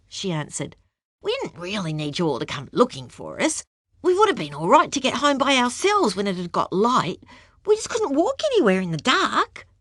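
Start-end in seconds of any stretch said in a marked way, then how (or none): 1.2-9.7 indignant. Acted. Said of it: "looked at him indignantly".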